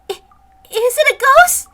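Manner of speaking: high, loud frightened question; squeaky panicked voice; scared squeak. Acted. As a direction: ask it trembling with fear. Scared character asks.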